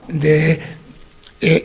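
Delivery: ordinary talk, nothing marked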